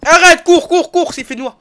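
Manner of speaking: panicked high yell to run; frantic shout, run away; scared horror game streamer. Real, not acted.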